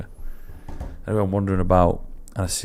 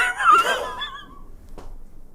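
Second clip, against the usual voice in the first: high squealing laugh; helpless laughter; 4 dB quieter than usual. Real, not acted. Host cracking up at his own blunder, squealing laugh. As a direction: collapse into a long, high laugh.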